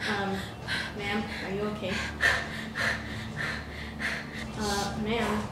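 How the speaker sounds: quiet, tentative check; soft concern. Acted. Worker softly checking on a dazed customer. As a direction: ask gently, unsure.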